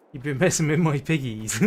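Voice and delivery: put-on country accent drifting into Welsh; mock farmer accent that slips towards Welsh; lilting, comically off-target Welsh accent; Welsh-leaning accent. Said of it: "That's more Welsh".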